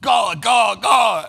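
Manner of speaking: loud God, God, God; loud exclamation. Real, not acted. Host reacts to his own joke with a loud outburst. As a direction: blurt it out loud.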